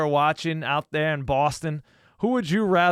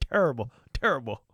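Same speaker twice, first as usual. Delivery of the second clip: imitating a gruff TV basketball analyst; Charles Barkley impression; 2 semitones lower than usual. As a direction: drag out terrible like Barkley. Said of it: "Sound like Charles Barkley over there".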